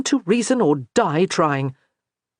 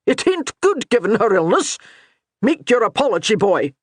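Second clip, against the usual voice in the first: ordering; 5 semitones higher, 3 dB louder than usual. A narrator's reading. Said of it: "he ordered".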